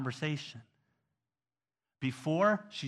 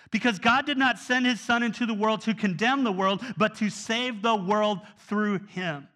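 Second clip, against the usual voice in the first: preaching; 5 semitones higher, 4 dB louder than usual. Acted. Preaching at full force.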